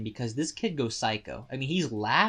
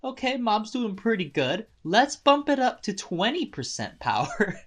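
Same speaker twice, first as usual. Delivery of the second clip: lightly voiced cocky villain, pitched up; mock Suzuki voice; 7 semitones higher than usual. Recapping the anime, Suzuki smugly raising his power, higher voice. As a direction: toss it off like a smug villain raising the stakes, higher. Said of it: "Suzuki starts to get like".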